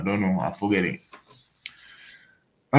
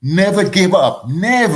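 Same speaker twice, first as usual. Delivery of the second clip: louder, punched Never give up; emphatic motto; 5 semitones higher, 6 dB louder than usual. Real, not acted. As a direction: drive the motto home. Motivational punchline to listeners.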